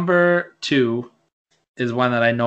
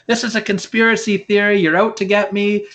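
higher-pitched, indignant conspiracy rant; mock paranoid protest; 8 semitones higher than usual. Wrestling fan mimics Jericho protesting a conspiracy, mock indignant. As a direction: protest like a wronged heel, voice raised in pitch.

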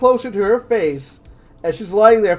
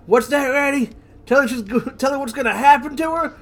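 creepy hillbilly villain; 5 semitones higher than usual. Acted. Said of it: "he's saying to her as he does so".

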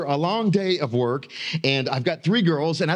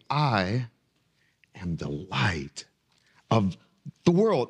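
calm certainty; 5 semitones lower, slower than usual. Retold or imitated. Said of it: "looking them in the eye without any reservation".

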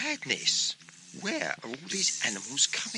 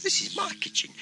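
high, whispered bee voice; high breathless whisper of a bee; faster than usual. Acted. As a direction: hiss it like a tiny bee. Bee claims the kitchen.

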